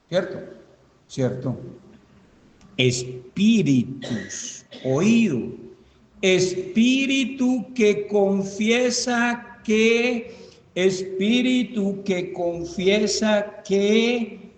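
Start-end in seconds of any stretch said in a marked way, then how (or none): 2.8-5.5 very slow, drawn-out syllables; slow dictation. Real, not acted. Dictating words to be written. 6.2-14.5 high, very drawn-out words; slow prompting dictation. Real, not acted. Dictating a verse slowly, prompting completion.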